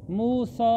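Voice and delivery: मूसा with the final aa held extra long; extra-long vowel. Shown as a demonstration. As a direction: stretch the "saa" of मूसा well out. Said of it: "तो और खींचना है".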